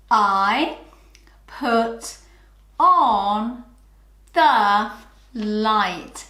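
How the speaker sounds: each word said separately with pauses; counting words. Shown as a demonstration. Teacher counting each word aloud for pupils. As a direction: tap out one word at a time. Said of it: "Let's count the number of words".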